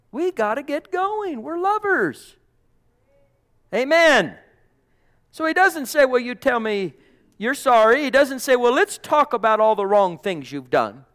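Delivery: loud, high, impassioned sermon; full-force preaching. Acted.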